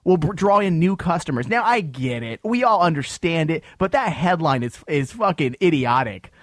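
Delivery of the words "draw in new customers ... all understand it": ordinary delivery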